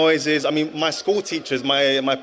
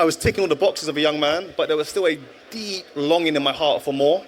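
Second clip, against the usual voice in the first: ordinary talk, nothing marked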